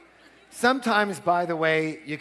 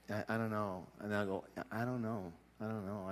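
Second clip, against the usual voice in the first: soft, lower hesitant murmur; acted reluctance; 5 semitones lower, 12 dB quieter than usual. Negotiator wavering after assistant's objection, softly reluctant. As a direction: play doubtful, holding back quietly.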